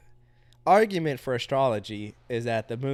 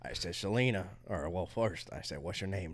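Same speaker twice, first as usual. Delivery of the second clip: deep cowboy voice; 9 semitones lower, 11 dB quieter than usual. Acted. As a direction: play a deep-voiced cowboy smooth-talker. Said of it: "It's hard to go back to normal voice than this".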